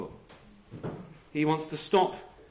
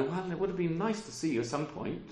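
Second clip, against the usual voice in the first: cool, offhand and softer; straight-laced; 8 dB quieter than usual. Pretend. Preacher mocks a stiff, unfeeling Paul, offhand and reserved. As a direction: shrug it off like a stiff, reserved man. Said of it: "sort of straight-laced".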